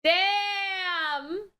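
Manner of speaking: ordinary talk, nothing marked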